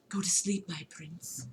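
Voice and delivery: voiceless whispered menace; ominous whisper. Acted. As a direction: breathe it like a threat in the dark.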